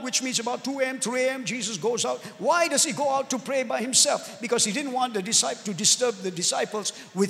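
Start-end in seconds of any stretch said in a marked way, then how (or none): none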